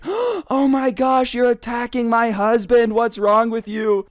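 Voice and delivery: very high, hammed-up outraged woman's voice; shrieking mom. Mom accusing worker of attacking husband, mock outraged shriek. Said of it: "the mom at that point is like".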